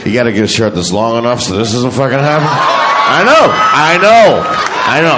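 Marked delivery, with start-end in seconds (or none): 2.5-5.2 raised, higher I know to the crowd; loud reassurance. Real, not acted. Comic answering audience groans, loud and high. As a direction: call back over the laughter.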